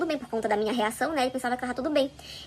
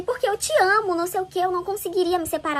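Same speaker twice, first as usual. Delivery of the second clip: retold pleading; 5 semitones higher than usual. Husband justifying himself to his wife, retold pleading.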